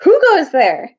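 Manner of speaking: loud, high challenge; dwarf sentry crying out a challenge; sharp challenge. Acted. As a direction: stop the intruder dead. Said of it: "a sharp dwarfish cry".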